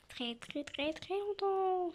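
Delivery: slow, rising emphasis on LONGTEMPS; drawn-out emphasis. Real, not acted. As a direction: stretch "très, très longtemps" right out. Drawing out how long ago.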